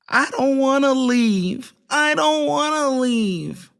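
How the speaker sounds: high, drawn-out imitation of a spoiled brat; exaggerated childish whining; mocking whine. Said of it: "If she whines".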